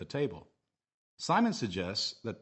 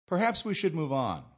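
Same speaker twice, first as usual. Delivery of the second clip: firm model phrase with a high start and falling end; firm falling intonation; 6 semitones higher, 4 dB louder than usual. Shown as a demonstration. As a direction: start "Perhaps" high, then fall through "move on". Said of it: "with a falling intonation at the end".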